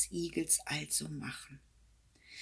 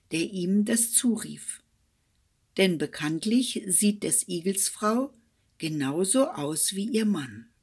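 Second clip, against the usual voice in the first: ordinary delivery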